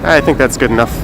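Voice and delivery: usual speaking voice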